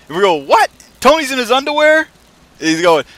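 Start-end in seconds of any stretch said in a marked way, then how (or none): 0.3-2.1 high, shouted mock disbelief; loud incredulous question. Crew answers the boss over the radio, shouting in mock bafflement. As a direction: yell it back as if you misheard.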